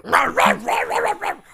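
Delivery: high loud mock barking; barking dog. Imitating the little dog barking at his fingers, high and loud. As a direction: yap like an angry little dog.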